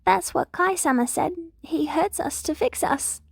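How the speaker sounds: put-on a timid girl repeating what she was told; little Eri's voice. Little Eri explains to Big Eri, high and timid.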